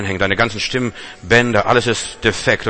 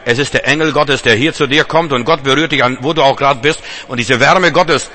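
rising preaching; 3 semitones higher, 3 dB louder than usual. Acted. Preaching to the congregation.

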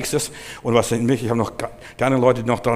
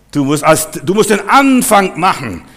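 loud, higher, punched key words; forceful preaching; 6 semitones higher, 6 dB louder, slower than usual. Real, not acted. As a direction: proclaim it to the congregation. (mit Nachdruck gepredigt)

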